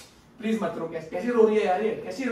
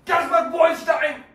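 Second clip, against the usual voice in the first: calling out; 6 semitones higher, slower than usual. Real, not acted. Calling out to the dog.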